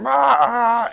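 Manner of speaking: high mock baby wails; imitating baby crying. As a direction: wail like an upset baby. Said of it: "the baby going".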